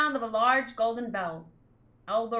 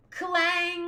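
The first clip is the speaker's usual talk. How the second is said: bell clang; 8 semitones higher than usual. Acted. Voicing the golden bell for the listeners, a ringing clang. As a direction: belt out the bell's clang.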